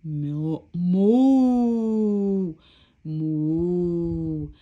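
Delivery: long drawn-out moos; big sustained cow moo. Acted. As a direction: moo loudly like a storybook cow. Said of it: "the cow grabs the book and goes".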